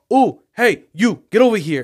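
loud, high imitated vendor calling someone over; retold call-out. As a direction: bark him over like a pushy seller. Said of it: "he was just like".

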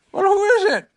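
high-pitched, calling out; Pooh. A narrator's reading. Pooh finds the caller outside, high loud voice. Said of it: "So he said".

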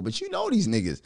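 usual speaking voice

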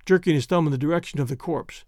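ordinary delivery, nothing marked

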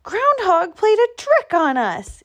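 high, indignant yell; high-pitched outraged squirrel. A narrator's reading. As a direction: shout out the accusation. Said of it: "he yelled".

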